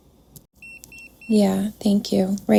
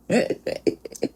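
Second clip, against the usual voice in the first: breathy laugh; 7 dB quieter than usual. Real, not acted. Laughs at spirit's joke.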